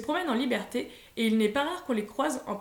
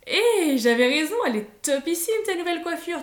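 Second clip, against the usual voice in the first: higher, louder and bubbly; excited gushing; 5 semitones higher, 5 dB louder than usual. A narrator's reading. Fiona admiring her cousin's new haircut, excited. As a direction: gush like an excited girl.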